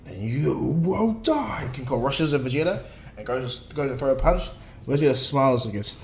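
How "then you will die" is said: very slow, drawn-out villain threat; menacing threat. Acted. King Cold threatening Vegeta, menacing. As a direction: stretch each word as a rising threat.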